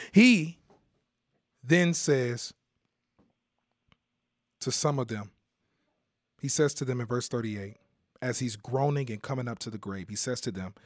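ordinary delivery

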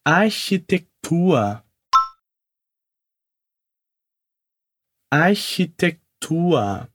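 slow Architektur for repeating; slow careful model word; slow demo. Shown as a demonstration. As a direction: stretch Architektur out slowly. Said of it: "Repete comigo".

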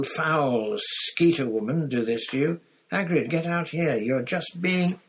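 high, insistent shout through a door. A narrator's reading. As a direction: raise your voice, exasperated and urging. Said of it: "Hermione shouted, pounding on the front door".

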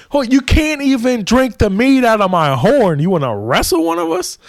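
high-pitched scoffing disbelief; mock incredulous. King to Thor who wants to wrestle, incredulous high mock scoff. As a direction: pitch it way up, as if the boast is absurd.

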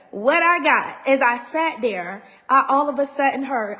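ordinary talk

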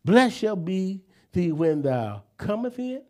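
slow, fading blessing recitation; solemn scripture reading. Acted. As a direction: intone the blessing like a benediction.

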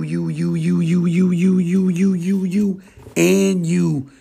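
building excitement. Acted. Pointing at listeners, building.

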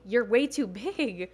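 firm coach. Retold or imitated. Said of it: "He's like".

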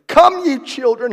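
ordinary delivery

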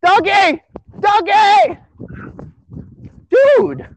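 high, loud yell across the street; shouting after a dog. Real, not acted. Man yells after a runaway dog, high loud shout.

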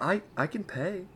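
small, high, hesitant voice; meek offer. Retold or imitated. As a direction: timidly offer to pay. Retold offer to pay on date.

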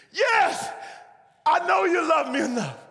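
very high, exasperated yell; desperate shouting. Acted. Dangling man assuring the voice above, desperate shout. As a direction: shout the answer straight up.

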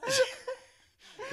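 breaking into high laughter on the name; laughing. Real, not acted. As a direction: laugh through the name, almost crying. Laughing while recounting the scene.

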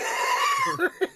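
real shrieking laugh. Real, not acted. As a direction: crack up with a squeal. Host cracks up at the story.

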